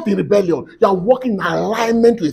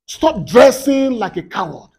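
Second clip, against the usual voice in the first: ordinary talk, nothing marked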